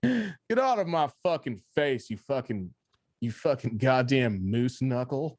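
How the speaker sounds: mock indignant TV host with a Southern accent; Dr. Phil. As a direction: drawl the insults in a hammed-up Southern accent. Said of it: "whatever Doctor Phil would say".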